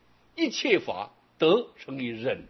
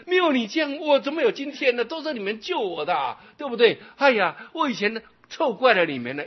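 grateful confession; 9 semitones higher, 7 dB louder than usual. Acted. Voicing a grateful repentant disciple.

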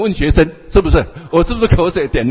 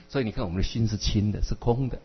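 ordinary delivery

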